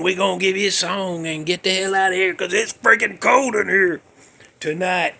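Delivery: usual speaking voice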